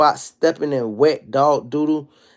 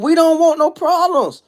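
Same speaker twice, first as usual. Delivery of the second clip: hammed-up frantic plea, much higher; mock scream; 12 semitones higher than usual. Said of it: "He was screaming".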